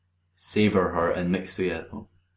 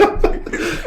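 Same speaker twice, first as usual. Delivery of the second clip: squeaky breathy laugh; high squealed laugh. Real, not acted. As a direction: break into a squeal. Laughs mid-song.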